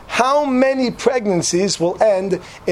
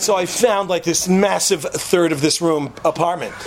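usual speaking voice

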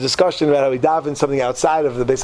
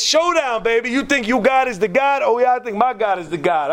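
cocky challenge; 5 semitones higher than usual. Acted. Eliyahu challenging Achav to a showdown, cocky and high. Acted challenge to rival prophet.

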